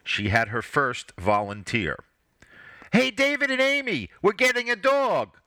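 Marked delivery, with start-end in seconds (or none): 2.9-5.4 put-on child's voice, much higher, louder call. A narrator's reading. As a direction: call across the house to her siblings. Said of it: "Holly called out".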